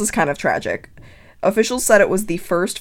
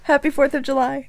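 teasing; 7 semitones higher, 4 dB quieter than usual. Real, not acted. Teasing while taking photo.

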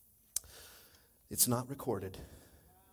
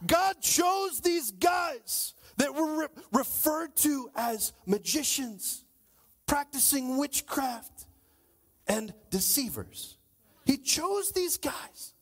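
preaching at full force; 7 semitones higher, slower than usual. Real, not acted. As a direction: hammer each phrase home slowly.

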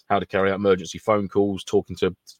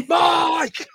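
shouting a name. Pretend. Calling out in a scream.

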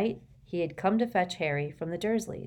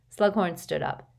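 ordinary delivery

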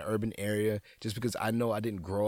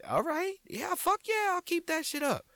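very high falsetto, half-embarrassed thanks; mock-sheepish high-pitched yes to a fan; squeaky high reply. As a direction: play yourself flustered in a much higher squeak. His own bashful reply retold.

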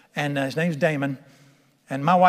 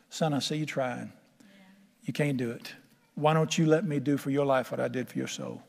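soft, gentle fatherly voice, much quieter; tender voice of God; 4 semitones lower, 6 dB quieter than usual. Acted. God gently reassuring his son, soft and tender. Voicing God speaking to him.

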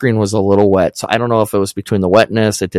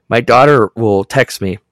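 ordinary talk, nothing marked

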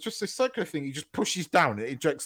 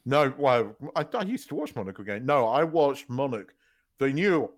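usual speaking voice

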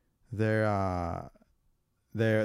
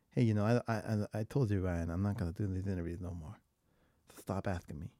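softer, lower Tyson impression; low Mike Tyson voice; 2 semitones lower, 7 dB quieter, faster than usual. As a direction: pull it down low and mumbly. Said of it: "he's more down here".

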